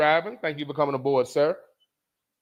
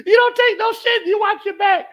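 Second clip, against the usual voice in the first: much higher, louder hammed-up shout; shouted lesson; 6 dB louder than usual. Real, not acted. Passing on his father's lesson, loud mock shout. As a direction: bellow it like a rule to live by.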